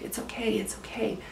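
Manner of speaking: softly imitating friends soothing her; hushed, gentle retold comforting; calm reassurance. Friends calming her while she freaks out, softly reassuring. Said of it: "being really supportive and calm".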